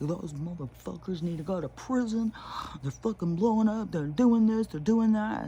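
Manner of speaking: hammed-up furious online ranting; mock outrage. People online ranting about Teslas, mock outrage. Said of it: "But on there, it's like".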